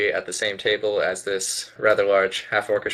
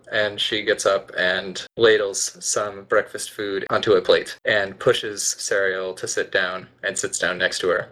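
ordinary talk

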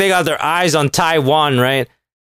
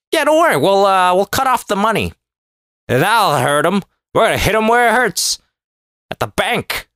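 mocking the world; 5 semitones higher than usual. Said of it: "reaction by the rest of the world".

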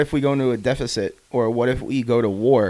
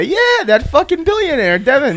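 high, loud gushing neighbor voice; 6 dB louder, slower than usual. Retold or imitated. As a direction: sing the praises in a high voice. Voicing an adoring neighbor.

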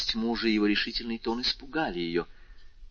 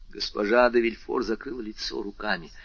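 ordinary delivery

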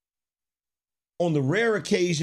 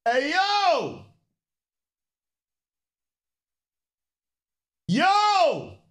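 calling out; much slower than usual. Real, not acted. Calling out to the kids.

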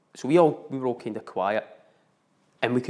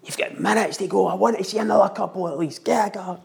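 coach shouting; 5 semitones higher than usual. Retold or imitated. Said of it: "He's shouting".

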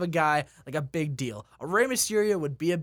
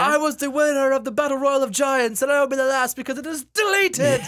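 high, loud, grandiose declaration; mock wrestler proclamation; 8 semitones higher, 6 dB louder than usual. Wrestler vowing to end the giants' battle royal, hammed-up.

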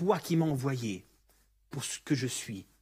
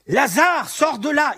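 full shout, much higher and louder; loud cry; 11 semitones higher, 12 dB louder, slower than usual. Acted. Jesus shouting for Lazarus to come out, full loud cry. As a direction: shout his name into the tomb. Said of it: "il crie d'une voix forte".

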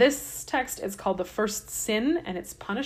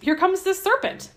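ordinary talk

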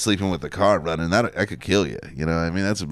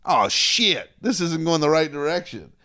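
alarmed realization; 8 semitones higher than usual. Retold or imitated. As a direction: catch the mistake in alarm. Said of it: "you're like".

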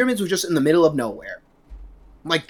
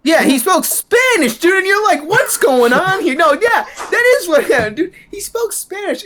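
loud, high-pitched burst of astonishment; excited realization; 13 semitones higher, 5 dB louder than usual. Real, not acted. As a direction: exclaim it as the memory floods back. Reacts excitedly to being reminded.

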